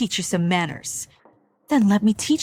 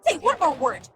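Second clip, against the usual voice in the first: angry warning; 6 semitones higher than usual. Acted. Mother threatens the man accusing Jean.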